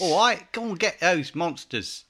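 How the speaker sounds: loud, high mock Cockney; hammed-up Cockney geezer, drawn out; Cockney accent. Co-host joking about the game kid, hammed-up Cockney. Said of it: "in a Cockney accent".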